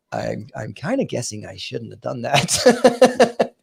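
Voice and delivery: slow, halting funny voice; comic slow-spoken impression; Elon Musk. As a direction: drag it out slowly in a funny mimicking voice. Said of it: "Elon Musk in his kind of funny voice".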